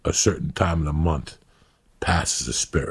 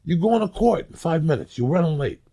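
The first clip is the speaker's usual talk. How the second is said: very high, incredulous back-and-forth; acted disbelief; 14 semitones higher than usual. Man waking before court argues about his dream, high and incredulous. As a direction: play mounting bafflement, voice pitched way up. Acts out escalating baffled dream exchange.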